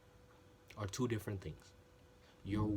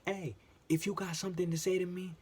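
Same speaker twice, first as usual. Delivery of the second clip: higher, louder mock yell at a coworker; retold screaming; 8 semitones higher, 4 dB louder than usual. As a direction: imitate her shouting in your face. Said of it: "Why are you screaming?".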